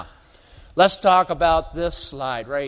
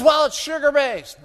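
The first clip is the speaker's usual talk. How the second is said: much higher imitated retort; mock indignant retort; 9 semitones higher, 4 dB louder than usual. Rancher arguing with the speaker, mock indignant retort. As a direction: snap back as the annoyed rancher, mock. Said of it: "he got all violent".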